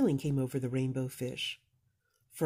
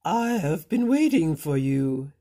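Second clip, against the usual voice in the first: a lightly voiced wise octopus, louder; deep octopus voice; 8 dB louder than usual. Wise octopus greeting Rainbow Fish, louder and ominous. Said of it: "with a deep voice".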